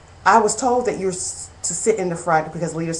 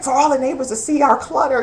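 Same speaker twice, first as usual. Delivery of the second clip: high-pitched mock mortification; retold embarrassment; 8 semitones higher than usual. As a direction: protest in embarrassed, raised pitch. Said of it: "it was just so embarrassing".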